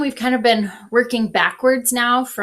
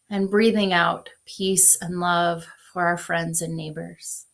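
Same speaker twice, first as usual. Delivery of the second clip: guided meditation; 3 dB quieter than usual. Acted. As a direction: ease them into breathing. Leads a breath prayer.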